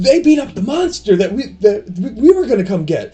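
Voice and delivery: put-on high, eager kid voice; excited boy king. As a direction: chatter like an eager little boy. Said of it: "Grover will be like".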